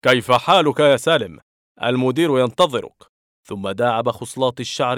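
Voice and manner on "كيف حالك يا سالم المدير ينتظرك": bright, louder greeting called out; a glad loud hello; cheerful exclaim. A narrator's reading. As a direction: hail him warmly across the room. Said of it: "وهتف".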